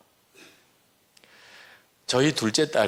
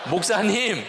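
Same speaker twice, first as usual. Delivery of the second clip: high, half-laughing imitation of a church member calling out; mock congregant outcry; 11 semitones higher than usual. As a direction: play the doubting congregation with a laugh in the voice. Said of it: "우리 교인들도 말은 안 하지만 이렇게 소리 지르겠구나".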